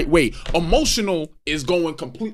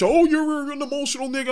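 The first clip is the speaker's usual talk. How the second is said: higher mocking girl voice; mocking her tone; 7 semitones higher than usual. Man imitating a woman calling him emotional, higher. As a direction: mimic her smug remark. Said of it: "She did that whole dumbass shit".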